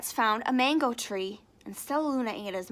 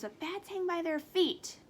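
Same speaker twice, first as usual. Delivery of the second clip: ordinary delivery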